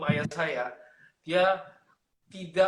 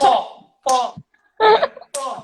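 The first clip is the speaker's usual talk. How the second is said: loud, high "pok" thwacks; spaced-out imitated smacks on a wall; tiruan bunyi pukulan; 8 semitones higher, 10 dB louder, slower than usual. Imitating rolled newspaper hitting wall. (tiruan bunyi pukulan keras)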